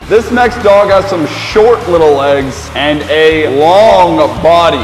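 slow, loud, drawn-out host intro; game show announcer. Acted.

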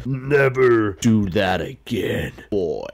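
slow, menacing villain impression; Thanos. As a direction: deliver a grand, drawn-out threat. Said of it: "sounds like Thanos".

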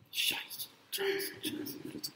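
hushed, barely audible whisper; whispered swearing. Real, not acted. Lecturer on Germanic cultures' swear words, whispering. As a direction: slip the rude word out under your breath. Taboo word dropped into lecture.

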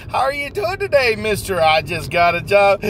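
ordinary talk, nothing marked

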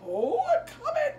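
mama llama. Acted. Teacher voicing Mama Llama answering her baby, high and laughing. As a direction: sing it out like a hurried mama. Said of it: "here she's like".

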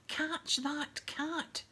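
high, drawn-out yell. A narrator's reading. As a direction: yell the order, stretching each word. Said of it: "Ron yelled".

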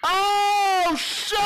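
high sudden yelp; startled yelp. Real, not acted. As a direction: yelp at a jump scare. Sudden scare.

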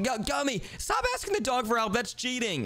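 high-pitched objection; high, urgent telling-off; indignant protest. Real, not acted. Protests at brother's cheating.

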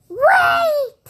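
high, loud shout; big voice. Pretend. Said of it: "I'm making a big voice".

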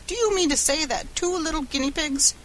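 high-pitched outraged question; incredulous. A narrator's reading. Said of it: "gasped Mister Morehouse".

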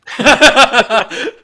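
loud high laugh outburst; loud burst of laughter. Real, not acted. Laughing at sofa joke.

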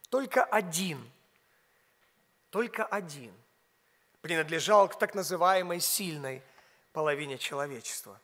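ordinary talk, nothing marked

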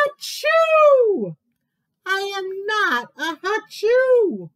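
high, loud hammed-up achoo; mock sneeze. Animal sneezing mid-insult, hammed-up achoo. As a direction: sneeze right through the word.

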